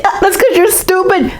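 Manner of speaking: mock him cruelly; taunting. Kids taunt Morgan, high and sneering.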